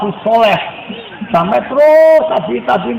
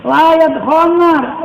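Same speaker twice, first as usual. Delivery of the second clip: drawn-out recitation; 7 semitones higher, much slower than usual. Acted. Arabic quoted, drawn out.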